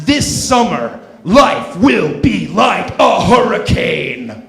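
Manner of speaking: slow loud trailer boom; trailer announcer. Pretend. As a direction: sell it like a blockbuster. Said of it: "go a little something like this".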